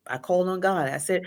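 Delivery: usual speaking voice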